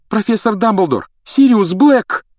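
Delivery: high, loud, excited call; urgent cry. A narrator's reading. Harry calling to Dumbledore as he enters, urgent.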